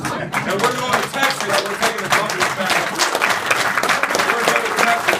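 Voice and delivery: rousing campaign cry, slowed on the first line; rally shout. Real, not acted. Rally crescendo, shouting the promise.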